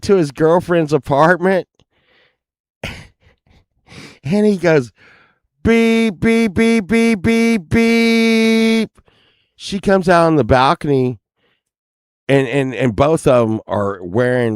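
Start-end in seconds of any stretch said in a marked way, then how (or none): 5.6-8.9 high, steady honking beeps; imitated car horn. Friend honking outside girlfriend's apartment, imitated car horn. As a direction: mimic a horn, last beep held long.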